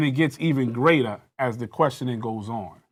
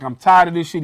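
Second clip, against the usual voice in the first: loud, raised retelling of a cop snapping; retold angry outburst; 4 semitones higher, 10 dB louder than usual. Retelling the officer flipping at him, loud angry outburst. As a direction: snap it out like the cop losing it. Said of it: "where he just flips".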